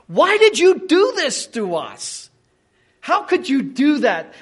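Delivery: mother's forceful rebuke. Retold or imitated. Mary confronts her missing son, reproachful. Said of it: "She means this".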